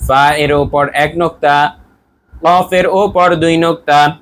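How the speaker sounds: teacher calling on everyone to repeat, in a slow chant; model recitation. Shown as a demonstration. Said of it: "সবাই বলুন".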